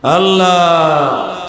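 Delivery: drawn-out invocation. Real, not acted. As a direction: stretch the name out slowly. Drawn-out cry to God mid-sermon. (টেনে টেনে আল্লাহকে ডাকা)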